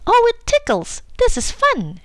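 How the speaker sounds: a giddy cry of ticklish fun; exclaiming. A narrator's reading. Young elephant blowing bubbles, squealing with delight. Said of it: "she exclaimed".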